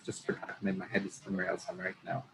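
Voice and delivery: a real unvoiced whisper to herself; muttered aside. Real, not acted. Losing her place in the trivia, muttering to herself. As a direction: drop it under your breath as you blank on the name.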